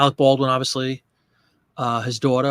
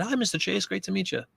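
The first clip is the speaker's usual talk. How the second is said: soft, higher-pitched courteous greeting; very polite; 5 semitones higher, 7 dB quieter than usual. Retold or imitated. Fan meeting Chevy Chase, very polite. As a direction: act out a meek, polite hello. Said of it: "And I was so polite".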